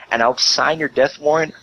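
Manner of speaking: usual speaking voice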